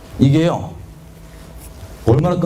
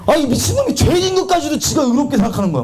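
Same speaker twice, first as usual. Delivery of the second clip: exasperated outburst; 8 semitones higher than usual. Real, not acted. Preacher shouting at himself before the congregation. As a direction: burst out at your own past self. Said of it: "미친놈 그랬더니 싹 눈빛이 다 놀라시네".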